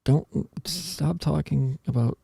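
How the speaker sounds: usual speaking voice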